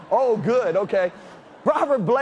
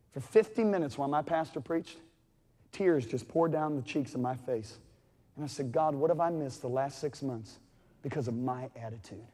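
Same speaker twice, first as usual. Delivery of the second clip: soft, low confession; a quietly moved preacher, soft and low; hushed, moved; 6 semitones lower, 8 dB quieter than usual. Real, not acted. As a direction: let it drop almost to nothing. Tearful memory.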